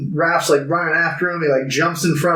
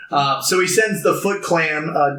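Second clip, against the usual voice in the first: ordinary talk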